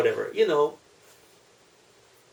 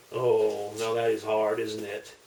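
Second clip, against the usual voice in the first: ordinary delivery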